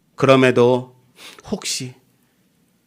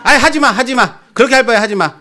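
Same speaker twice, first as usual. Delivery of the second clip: exasperated wife; 7 semitones higher, faster than usual. Retold or imitated. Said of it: "그러겠어요".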